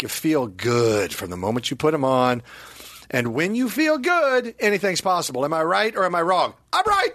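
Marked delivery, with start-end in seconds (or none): 3.1-7.1 over-the-top ad read; hammed-up ad read. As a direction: sell it like a cheesy pitchman.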